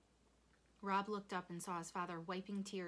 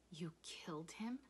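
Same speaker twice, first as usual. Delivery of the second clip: hushed disbelief, rising at the end; stunned question; 3 dB quieter than usual. A narrator's reading. Rob to his father over the tiger, stunned. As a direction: ask it under your breath.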